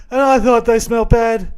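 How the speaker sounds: loud, high strained impression; Han Solo. Quoting Empire Strikes Back, loud high impression. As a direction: call it out high and loud, imitating a film hero. Acts out Han Solo's film line.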